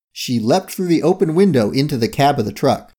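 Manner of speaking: plain reading voice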